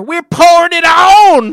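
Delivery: loud boastful fan chant; hyped shout. Pretend. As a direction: yell it like a rowdy fan.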